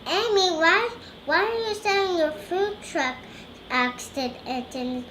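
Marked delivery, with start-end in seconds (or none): none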